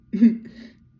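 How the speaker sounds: sudden loud burst of laughter. Real, not acted. Laughing at the count.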